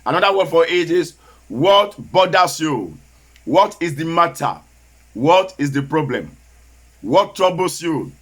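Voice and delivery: ordinary talk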